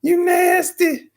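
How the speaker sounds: very high, loud, slow squeal; disgusted kid squeal. Retold or imitated. Classmates mocking his snotty hair, high squeal. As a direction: drag it out like shrieking grossed-out kids. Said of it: "they was like".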